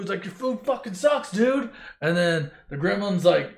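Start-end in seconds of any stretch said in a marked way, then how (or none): 0.2-1.7 mad. Retold or imitated. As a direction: gripe like an annoyed kid, pitched up. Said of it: "Luke gets all mad about the food".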